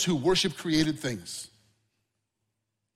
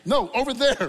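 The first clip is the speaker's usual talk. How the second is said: higher and louder, insistent; calling to the dog; 8 semitones higher, 5 dB louder than usual. Retold or imitated. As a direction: steer the dog's gaze away from your finger. Redirecting the staring dog.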